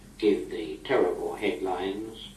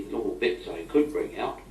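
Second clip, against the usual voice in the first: usual speaking voice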